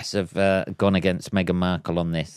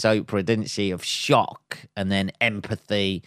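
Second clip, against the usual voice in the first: ordinary talk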